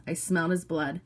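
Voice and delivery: ordinary delivery, nothing marked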